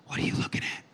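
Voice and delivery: hushed whispered question. Retold or imitated. Asks the staring stranger in the museum, whispered. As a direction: whisper it so nobody else hears.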